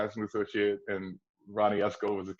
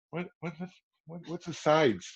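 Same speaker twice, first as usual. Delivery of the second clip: put-on confused kid; 7 semitones higher than usual. Clueless kid at audition.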